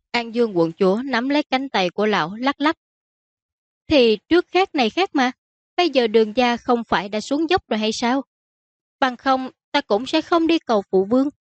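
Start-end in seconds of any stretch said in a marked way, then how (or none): 3.9-11.3 higher-pitched, insistent daughter; arguing princess. Acted. Princess arguing why she needs her father's help, insistent. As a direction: press the case in a raised-pitch voice.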